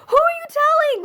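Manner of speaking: very high, squeaky mock panic; hammed-up panicked high voice; retold alarm. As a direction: squeal the question in feigned horror. Alarmed at mom phoning, retold.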